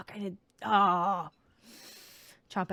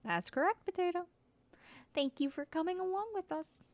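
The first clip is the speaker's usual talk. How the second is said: chirpy, bossy critter voice; 12 semitones higher than usual. Acted. High voiced character line.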